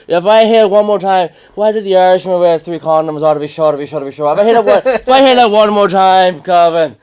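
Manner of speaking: put-on loud, high Irish accent, ranting; imitating loud Irishman. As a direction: rant as the loud Irish mate mocking Irish cliches. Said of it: "He's like".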